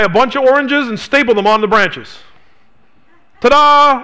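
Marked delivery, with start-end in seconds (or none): 3.4-4.0 mock ta-da. Mock magician's reveal.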